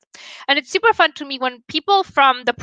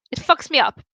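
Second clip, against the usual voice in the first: ordinary talk, nothing marked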